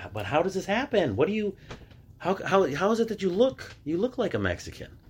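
mimicked bewilderment, pitched well up; high-pitched, halting mock disbelief; baffled questioning. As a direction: imitate a puzzled questioner in a high voice. Acting out baffled people questioning him.

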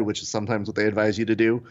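ordinary talk

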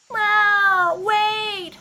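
high, loud cry after her son; mother crying out. Acted. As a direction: call him back, high and loud. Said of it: "his mother cried".

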